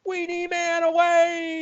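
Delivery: high slow shout. Retold or imitated. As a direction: belt it out high and slow like a cartoon hero. Repeating the movie catchphrase.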